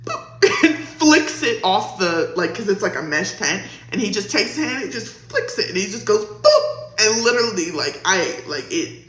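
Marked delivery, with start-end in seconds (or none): 0.0-0.6 squeaky little boop, up high; high boop sound. Retold or imitated. Telling her audience how he flicked the spider, high boop. 6.2-7.0 squeaky little boop, up high; high boop sound. Retold or imitated. Telling her audience how he flicked the spider, high boop.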